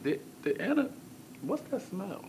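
puzzled disgust. Retold or imitated. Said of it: "I'm sitting here like".

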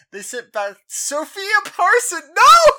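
high, loud cry of dismay; anguished shout. Real, not acted. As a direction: wail at the loss. Reacting to losing player.

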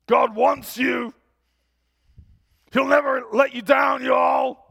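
very loud, high, thunderous preacher shout; Vernon. Retold or imitated. Old biker exhorts the congregation at the microphone, thunderous shout. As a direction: boom it out to the whole room. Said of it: "it's like rolling thunder".

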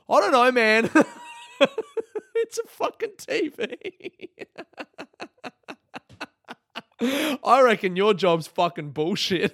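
high, loud, cracking up mid-retort; exasperated mock retort breaking into laughter; laughing outburst.

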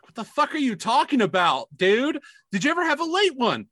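can't believe what you're hearing; mock baffled.

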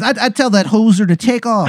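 high, hammed-up Canadian accent; Angel of Canada, Canadian accent. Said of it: "it is a horrible Canadian accent".

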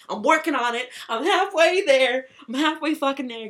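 loud, high self-hype; emphatic, high-energy declaration; fired up. Real, not acted. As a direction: shout it proudly, drawing the words out. Hyping up her own progress.

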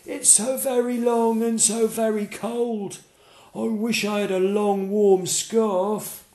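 high, slow giraffe voice; a long-necked animal's lament. Acted. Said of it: "said the giraffe".

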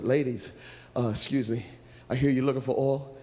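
low, restrained passer-by; low-voiced helpful stranger; ordinary man. Angel disguised as ordinary man speaks.

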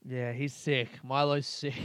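ordinary talk

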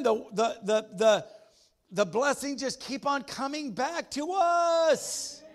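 high, loud preaching to the congregation; full-force preaching. Acted. Pastor firing up his church about blessing, loud and high. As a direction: rouse the room, call for amens.

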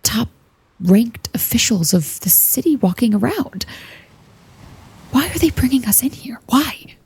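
hushed unvoiced whisper; stealth whisper. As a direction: whisper it so the guards miss it. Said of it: "stealth whisper".

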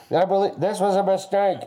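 high, lightly voiced regretful guy; mock dumb guy voice. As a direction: play the cup-stuck guy, muffled. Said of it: "It's like".

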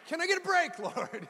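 high, laughing complaint to God; mock plea.

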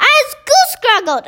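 high, hammed-up howl; giant's howl. A narrator's reading. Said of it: "howled the man hugger".

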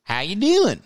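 high, twangy Southern drawl imitation; mock a sweet Southern greeting; Southern accent. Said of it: "She's like".

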